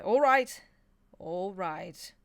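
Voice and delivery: loud cut-off that settles; the embarrassed demon silencing the angel's praise, snapping; snapped. Acted. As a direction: cut him off sharply, then calm down. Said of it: "Crowley snapped".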